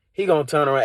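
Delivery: ordinary delivery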